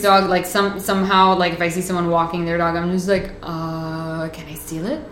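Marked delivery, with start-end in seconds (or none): 3.4-5.0 quiet, lower, sheepish wish; sheepish low aside. Retold or imitated. To herself seeing a stranger's dog, a quiet sheepish ask. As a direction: ask it softly like a guilty secret. Voicing own urge for dog.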